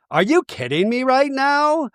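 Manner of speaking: high, incredulous retold question; mock outrage. Acting out the friend compared to Jen Shah, mock outrage. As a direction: pitch it way up in hammed-up disbelief.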